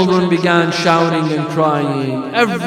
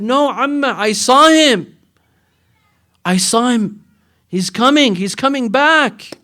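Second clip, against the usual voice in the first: put-on higher, urgent little girl voice; child insisting; 6 semitones higher than usual. Little girl insisting to her aunt, higher voice. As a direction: plead like a small child sure of it. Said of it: "She said".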